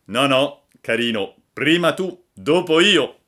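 cheeky, emphatic back-and-forth; playful insistence. Acted. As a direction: insist firmly that the other go first. Boys argue who shows first.

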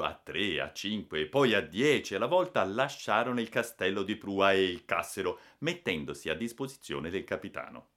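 plain reading voice

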